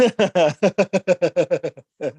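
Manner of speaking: high, sustained peal of laughter; real laughter. Real, not acted. Host amused at teacher's missing sarcastic comment, laughing. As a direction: laugh freely in a long run.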